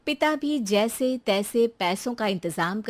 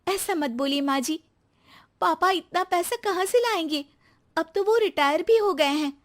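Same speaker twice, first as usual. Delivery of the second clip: put-on much higher young wife's voice, pleading; pleading daughter-in-law; 9 semitones higher than usual. Daughter-in-law pleading to spare her retired father, higher pitched.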